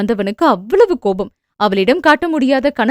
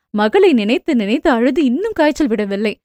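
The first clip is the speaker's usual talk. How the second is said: usual speaking voice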